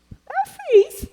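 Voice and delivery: put-on high, bright boyish reply; proud young man. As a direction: answer like a proud young convert. Young man answering pastor proudly. (resposta alta de rapaz)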